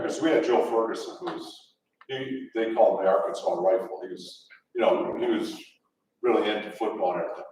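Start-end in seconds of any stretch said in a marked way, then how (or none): none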